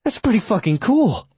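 put-on high, impressed young woman. A narrator's reading. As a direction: say it with awe. Granuaile voiced by narrator.

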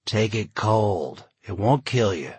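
plain reading voice

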